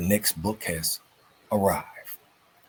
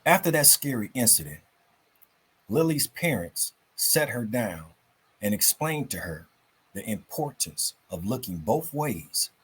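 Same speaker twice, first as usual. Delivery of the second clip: put-on lively storybook reading for kids; story narration; 3 semitones higher than usual. A narrator's reading. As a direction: tell it like a bedtime story, bright and animated. Reads story to children.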